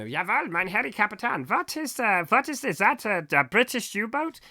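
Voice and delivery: put-on high-pitched comic German accent; put-on German accent, pitched well up. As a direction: play a German sailor reporting to his captain in a heavy German accent. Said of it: "in a German accent".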